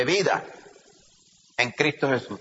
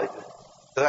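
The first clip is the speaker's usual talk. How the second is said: ordinary talk, nothing marked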